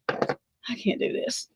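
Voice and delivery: slow, breathless whisper, barely voiced; overwhelmed whisper. Real, not acted. A shaken viewer whispering to her audience, slow and hushed. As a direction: let the words fall out in a slow whisper.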